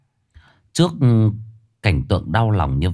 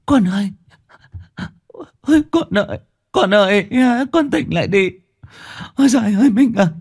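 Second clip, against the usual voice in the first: high-pitched keening cry of a grieving mother; hysterical, sobbing howl; anguished wailing. Acted. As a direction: wail desperately for help, voice pitched way up. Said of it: "mà gào lên".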